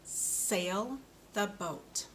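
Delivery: "Sail the boat" said very slowly, each word apart; slow model sentence. Shown as a demonstration. Speech therapist models a sentence for a child, slow and careful. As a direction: lay it out slowly for the learner. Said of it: "You ready?".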